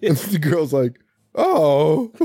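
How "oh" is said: drawn-out, higher "oh"; lightly hammed-up surprise; mock dismay. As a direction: imitate a stunned girlfriend taking it in. Retold girlfriend hears the news.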